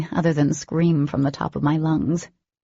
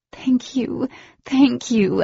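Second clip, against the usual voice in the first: high, gushing thanks; excited gratitude; 8 semitones higher, 4 dB louder, slower than usual. A narrator's reading. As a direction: thank him over and over, thrilled.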